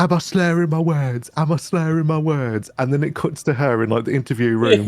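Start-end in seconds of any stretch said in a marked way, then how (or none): 0.0-2.7 higher, indignant drunk girl imitation; hammed-up tipsy girl insisting she's fine; imitating a drunk girl denying it, pitched up; mock drunk protest. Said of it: "And she was like".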